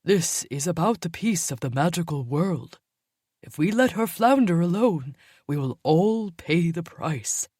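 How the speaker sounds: put-on older wolf arguing for the bigger picture; arguing older male. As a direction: push back steadily. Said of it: "the older male argued".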